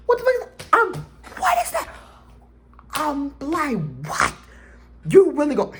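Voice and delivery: very high, squealed disbelief; shocked shrieking. Retold or imitated. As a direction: act out a stunned, squeaky outburst. Retelling shock at the video.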